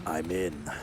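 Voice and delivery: hacker voice. Pretend. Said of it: "That's my hacker voice".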